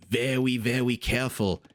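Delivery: quoting a cartoon hunter, lightly voiced; a hint of cartoon speech with softened r's; Elmer Fudd. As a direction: slip briefly into the cartoon hunter's lisping r's. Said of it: "quote Elmer Fudd".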